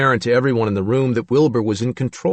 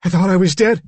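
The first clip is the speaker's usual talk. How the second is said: high, loud but less explosive; anxious, raised character voice; frightened Billy; 8 semitones higher, 5 dB louder than usual. A narrator's reading. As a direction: explain it like a bewildered boy. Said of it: "he said".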